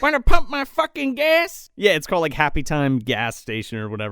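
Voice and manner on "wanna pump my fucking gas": high, loud hammed-up yokel; sneering yokel taunting customers. Podcaster mocking the tow-truck guy, hammed-up yokel voice.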